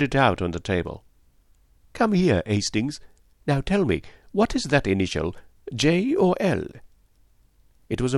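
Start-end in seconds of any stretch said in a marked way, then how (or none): 1.9-6.7 put-on accented detective, high questioning finish; lightly voiced Poirot. Poirot quizzing Hastings on an initial, detective voice. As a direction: summon and quiz him as the detective.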